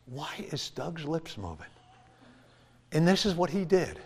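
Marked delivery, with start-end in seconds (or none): 0.1-1.7 inner alarmed thought. Retold or imitated. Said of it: "I'm like".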